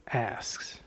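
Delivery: breathy whispered "asks". Shown as a demonstration. Preacher pointing out Paul's chosen word, whispered.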